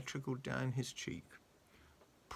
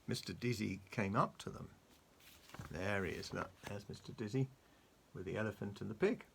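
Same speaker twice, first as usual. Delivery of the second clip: usual speaking voice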